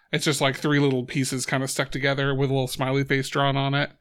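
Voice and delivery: ordinary delivery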